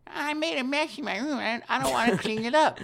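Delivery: put-on very high childish complaint; mock bratty kid voice; whiny little kid. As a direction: whine like a spoiled child refusing chores. Playing a child refusing babysitter chores.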